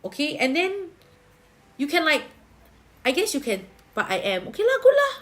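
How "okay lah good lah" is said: excited. Real, not acted. Reacting happily to viewer's update.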